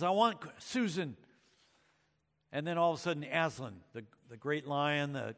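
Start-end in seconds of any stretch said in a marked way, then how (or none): 0.0-1.2 mild mock rant. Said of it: "he's ranting and raving".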